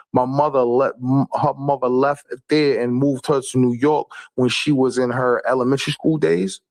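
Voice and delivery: usual speaking voice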